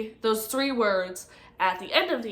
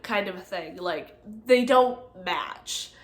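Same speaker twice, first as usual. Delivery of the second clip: ordinary talk, nothing marked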